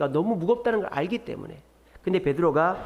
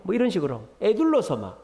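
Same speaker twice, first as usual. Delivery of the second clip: ordinary delivery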